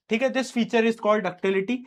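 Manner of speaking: ordinary talk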